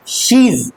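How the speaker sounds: she is run together as she's; contracted she's. Shown as a demonstration. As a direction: blend she is quickly. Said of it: "she is नहीं". (she is को जोड़कर she's की तरह)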